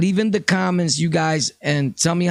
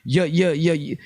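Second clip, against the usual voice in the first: ordinary delivery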